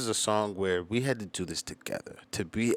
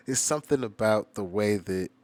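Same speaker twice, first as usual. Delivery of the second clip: usual speaking voice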